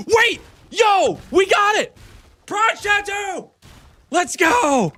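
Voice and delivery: yelling with joy, high; big excited cheer; excited shout. Real, not acted. Celebrating a rare drop.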